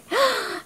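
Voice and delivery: sharp gasp of surprise; high shocked gasp. Acted. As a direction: gasp as if stunned.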